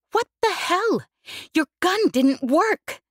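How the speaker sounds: high, loud, slowed outcry of disbelief; alarmed shout. A narrator's reading. Jez shouting at Yesbel while running from gunfire, loud and high. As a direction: yell it at the gunsmith, on the run.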